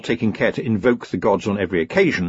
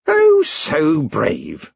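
slow, drawn-out sneer; mock his bravery slowly; 6 semitones higher, 4 dB louder, much slower than usual. A narrator's reading. Queen sneers.

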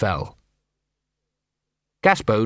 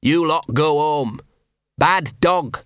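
barked order; 4 semitones higher, slower than usual. Acted. Gaspode orders the watching dog pack home, sharp bark. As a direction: shoo them off sharply. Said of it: "he barked".

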